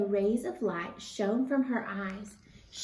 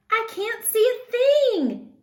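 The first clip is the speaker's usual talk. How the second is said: much higher, louder, slow panicked yell; frightened yell; 13 semitones higher, 9 dB louder, slower than usual. A narrator's reading. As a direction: yell blind in the dark sky. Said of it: "yelled Pip".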